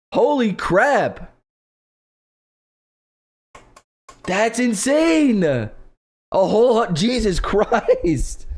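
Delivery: slow, high, laughing in disbelief; breathless amazement trailing into laughter; stunned disbelief. Real, not acted.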